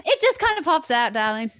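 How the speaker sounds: playful affected voice. Pretend. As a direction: ham it up through a laugh, high and loud. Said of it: "I love how we do random voices and accents".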